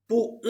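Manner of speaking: "Pu-" cut in the throat by a glottal stop; throat catch after "Pu-". Shown as a demonstration. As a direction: say "Pu-" and close the throat before the final vowel. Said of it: "más o menos en la garganta".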